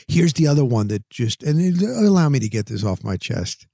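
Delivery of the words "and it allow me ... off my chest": usual speaking voice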